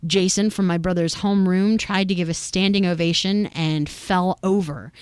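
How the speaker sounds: usual speaking voice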